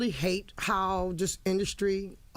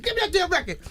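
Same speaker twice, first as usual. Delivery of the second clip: higher and louder, eager grab; snatch it, thrilled; excited demand; 12 semitones higher, 7 dB louder, faster than usual. Retold or imitated. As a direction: mom thrilled by son's record, eager loud demand. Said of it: "I said".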